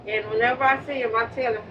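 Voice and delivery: ordinary talk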